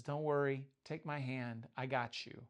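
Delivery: soft, low, gentle reassurance; reassuring Jesus. Retold or imitated. Jesus reaching out to sinking Peter, soft and reassuring. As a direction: hush him like a rescuer.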